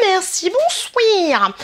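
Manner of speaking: high, drawn-out comic exclamation; mock outraged sign-off. Vlogger reacting to her big receipt, theatrically drawn out. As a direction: stretch it out high, swooping down at the end.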